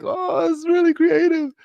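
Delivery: high sarcastic put-on voice; mock the name with fake praise; high, sneering mock compliment. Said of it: "although I'm making fun of it".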